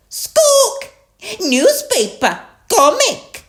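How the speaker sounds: very high, loud parrot copying; Polly Parrot. A narrator's reading. Polly copies the dogs' order, squawky and high. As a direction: squawk each word out separately. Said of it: "Polly Parrot copied".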